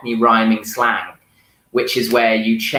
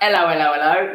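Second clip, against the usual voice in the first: high Cockney "ello, ello, ello"; Cockney greeting, H dropped, pitched up; Cockney accent; 6 semitones higher, much faster than usual. Shown as a demonstration. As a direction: chirp it in Cockney with no H. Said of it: "exactly. That's exactly right".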